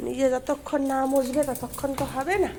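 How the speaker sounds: normal talking voice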